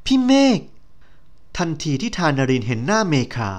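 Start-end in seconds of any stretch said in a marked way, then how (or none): 0.1-0.6 high, sudden cry of his name; a heroine shocked to see her old senior; startled recognition. Acted.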